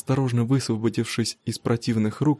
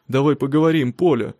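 louder, insistent husband's plea; urgent appeal; 3 semitones higher, 5 dB louder than usual. A narrator's reading. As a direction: urge her to talk, raising the voice. Husband urgently grabs departing wife.

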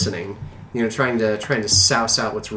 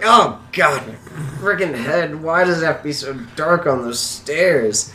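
put-on raised-pitch grumbling of a hurt old man; swearing under breath; 4 semitones higher than usual. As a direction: grumble and curse at your sore head. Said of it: "still sort of swearing under his breath".

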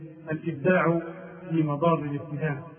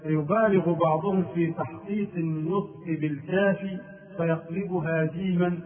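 ordinary talk, nothing marked

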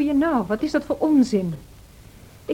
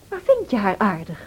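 usual speaking voice

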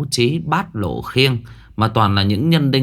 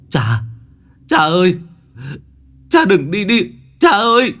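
rising high, loud anguished calls; a son wailing after his father's ghost; crying out to father; 10 semitones higher, 5 dB louder than usual. A narrator's reading. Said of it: "kêu gào".

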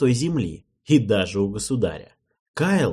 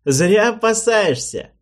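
loud, high, slow smirk; smirking king; 11 semitones higher, 8 dB louder, slower than usual. Acted. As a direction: scoff at the worry. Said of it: "усмехнулся король".

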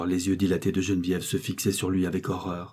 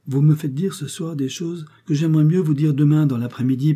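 higher, louder courting plea; raised, lively storybook suitor; lightly voiced insistent suitor; 8 semitones higher, 6 dB louder than usual. A narrator's reading. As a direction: lightly play a man pressing his advances.

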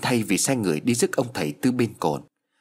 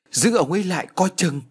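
high, loud frantic shout; panicked cry; 9 semitones higher, 3 dB louder than usual. A narrator's reading. Wife panicking as husband walks into river, high frantic shout. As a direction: call out in panic to stop him. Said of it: "bà hội đồng hốt hoảng".

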